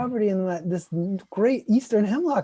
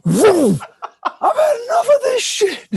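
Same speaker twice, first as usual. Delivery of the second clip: much higher, louder laughing yell; mock rampage shout; 12 dB louder than usual. Speaker pretending to storm the neighbor shirtless, high, loud laughing shout. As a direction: whoop like a maniac. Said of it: "Take my shirt off".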